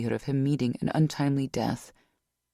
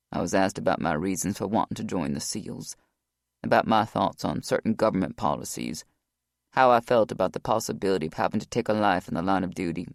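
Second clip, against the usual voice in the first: lower, steady male voice; Rock's voice; 7 semitones lower than usual. A narrator's reading. Rock retells the interview.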